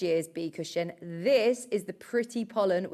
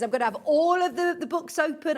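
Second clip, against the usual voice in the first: higher-pitched excited quote; excited retelling; 6 semitones higher, 4 dB louder than usual. Retold or imitated. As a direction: imitate her eager excitement. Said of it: "she is so excited".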